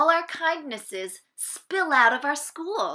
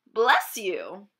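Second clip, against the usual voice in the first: bless you; 2 semitones lower, 5 dB louder than usual. Acted. Responding to the sneeze.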